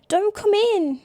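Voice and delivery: high, loud panicked cry. Acted. Brother panicking as sister forces the door. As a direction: stop her with panic. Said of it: "He sounds panicked".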